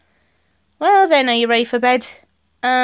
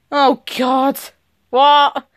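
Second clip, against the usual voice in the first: normal talking voice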